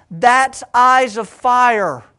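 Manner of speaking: loud, high and slow, every word weighted; proclaimed. Acted. Preacher driving home his point, proclaiming loudly. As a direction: proclaim it like a revelation.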